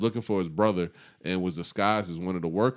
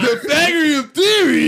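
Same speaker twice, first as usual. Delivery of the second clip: mock excited shout; 11 dB louder than usual. Real, not acted. Mocking friend's deep theory.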